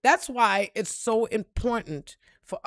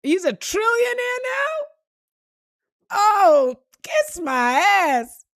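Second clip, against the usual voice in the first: much higher and louder, slow dragged "kiss my ass"; incredulous outburst; 12 semitones higher, 8 dB louder, slower than usual. Retold or imitated. Reacting to Musk becoming a trillionaire, loud incredulous outburst. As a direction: explode in disbelief, drag the end. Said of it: "and I'm like".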